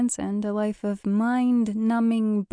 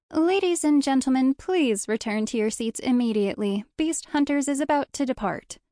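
higher, louder tour-guide announcement; urgent call-out; 5 semitones higher, 3 dB louder than usual. A narrator's reading. Tour guide calls sightseers back to the bus, urgently. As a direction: call out urgently to a crowd of passengers. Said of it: "called out urgently".

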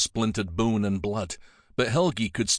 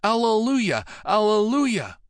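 joyful shout; 11 semitones higher, 4 dB louder than usual. Acted. All the monks together, shouting in joy. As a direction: shout it to the heavens. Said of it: "the brothers shouted as one".

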